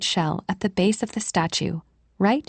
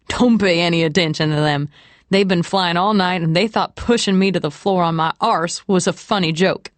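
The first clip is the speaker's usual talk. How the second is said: lightly voiced man, firm delivery; Jack, joking traveler. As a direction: play a hint of joking protest.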